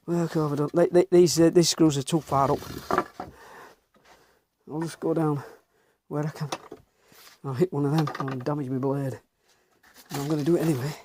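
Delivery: ordinary delivery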